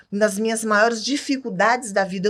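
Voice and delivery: usual speaking voice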